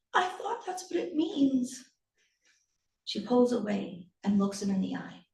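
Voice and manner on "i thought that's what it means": small, high, soft voice; high, quiet little-boy voice; hurt child. Acted. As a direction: explain meekly after a scolding. Scolded child explains himself.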